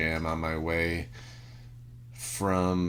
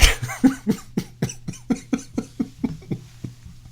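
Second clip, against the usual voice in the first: breathy laugh. Real, not acted. Laughing after cursing truck.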